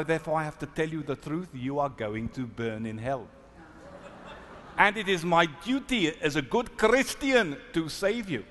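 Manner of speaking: imitating a South African minister's accent; a mild South African accent, earnest and preachy. Minister telling the rabbi he will burn, South African accent. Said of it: "I have to do it with a South African accent".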